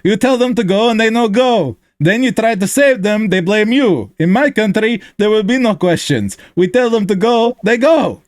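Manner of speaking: put-on heavy Russian accent, pitched high; blunt soldier in broken English; thick Russian accent. Hammer reads it again on request, in a thick Russian accent. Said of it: "Read that for me again".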